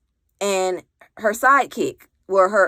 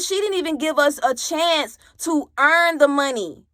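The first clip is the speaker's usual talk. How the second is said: higher, indignant snap-back; indignant retort; 7 semitones higher than usual. Retold or imitated. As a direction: fire it back in disbelief. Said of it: "I said".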